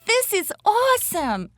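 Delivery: high-pitched delighted exclamation; exclaiming out loud. A narrator's reading. Little girl watching shooting stars, high excited exclamation. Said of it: "she said out loud".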